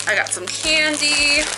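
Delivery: normal talking voice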